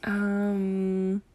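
ordinary talk

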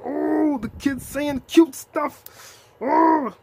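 put-on emotional fan cooing over the boy; gushing over cute. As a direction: melt and coo at the kid. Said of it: "I was like".

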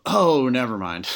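imitated student suddenly getting it; sudden realisation. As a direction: act out the penny dropping. Student suddenly gets it, acted.

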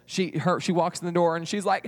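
plain reading voice

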